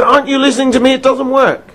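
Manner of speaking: high, indignant retort; imitating an irate caller; angry caller. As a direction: throw it back, exasperated. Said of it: "He was incredibly angry".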